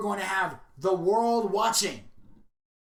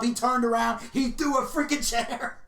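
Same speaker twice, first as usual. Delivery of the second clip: usual speaking voice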